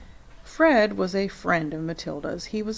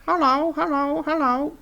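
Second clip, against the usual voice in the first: much higher, squawky bird greeting; parrot voice; 8 semitones higher, 5 dB louder than usual. A narrator's reading. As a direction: chirp it like a talking parrot. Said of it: "exactly like a human voice".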